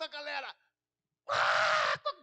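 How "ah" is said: loud high jeering burst; a high scornful shout of laughter; the crowd laughing in his face, loud; mocking crowd laugh. Dando risada na cara dele.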